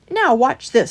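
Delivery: fast, high, loud welcome; hearty badger welcome. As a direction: welcome him in eagerly.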